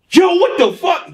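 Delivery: high, loud astonished cry; shocked exclamation. Retold or imitated. Engineer stunned by the gold in the cave, high and loud. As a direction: blurt it out in shock.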